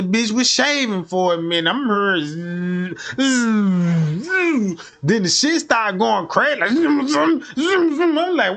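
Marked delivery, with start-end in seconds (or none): 1.7-4.9 buzzing imitation. 6.6-8.2 revving zoom. Retold or imitated. Comic retelling of hearing the vibrator go wild, high revving zooms.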